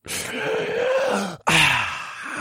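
high, drawn-out creature sigh; long high sigh. A narrator's reading. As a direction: let out a long eerie sigh. Monster rises from the earth.

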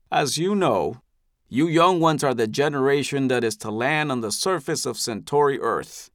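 a patriarch charming his people; benevolent elder. Acted. Eldest addresses the crowd.

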